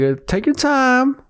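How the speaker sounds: high, slow imitation of the cashier; drawn-out, patient cashier voice. Retelling the cashier at the drive-thru, slow patient voice. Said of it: "She's like".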